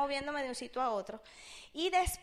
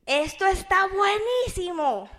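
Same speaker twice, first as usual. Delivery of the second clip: slow, loud mock enthusiasm; hammed-up loud praise, drawn out; imitating overheard hype; 5 semitones higher, 8 dB louder, slower than usual. Father pitching a business behind the door, loud and slow. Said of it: "es algo así como que".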